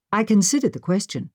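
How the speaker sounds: usual speaking voice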